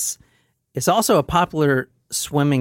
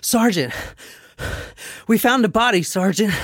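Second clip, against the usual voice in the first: higher-pitched panting soldier; out of breath; 7 semitones higher than usual. Acted. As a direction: gasp it out between breaths, acting winded. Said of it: "they're out of breath".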